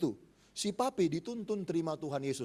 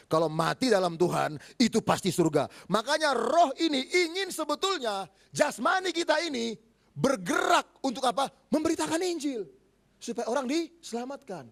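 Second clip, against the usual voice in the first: louder, higher preaching push; 7 semitones higher, 6 dB louder than usual. Acted. Preacher urging the church to spread the gospel, fervent. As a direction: drive the point home to the congregation.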